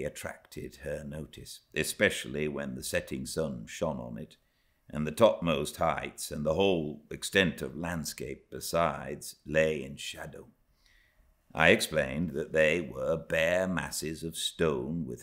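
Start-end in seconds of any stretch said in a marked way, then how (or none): none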